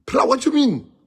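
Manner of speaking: what are you on about; incredulous call. Retold or imitated. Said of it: "I said".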